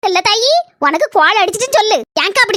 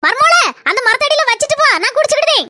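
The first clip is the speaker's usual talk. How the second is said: calling back; 6 semitones higher than usual. Acted. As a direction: shout the answer back from the field. Mother-in-law calling back.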